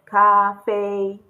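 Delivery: 咖啡 loud and level on both syllables; two flat tones. Shown as a demonstration. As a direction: hold 咖啡 evenly with no rise or fall. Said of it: "the two flat tones will be pronounced flatly".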